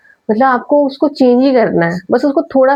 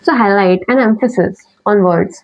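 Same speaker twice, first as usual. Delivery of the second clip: slow, every word given time; slow deliberate read; 2 semitones lower, much slower than usual. Shown as a demonstration. Teacher reading summary points to students, slow and clear. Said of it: "तो clarity होनी चाहिए".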